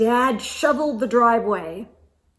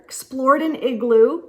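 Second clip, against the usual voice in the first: ordinary delivery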